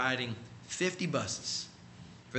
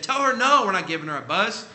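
higher, louder brush-off from the manager; curt refusal; 4 semitones higher, 6 dB louder than usual. Retold or imitated. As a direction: snap the refusal down the line. Manager refusing over the phone.

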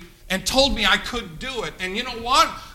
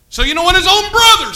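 loud; 10 semitones higher, 9 dB louder than usual. Real, not acted. Preacher building to a shout.

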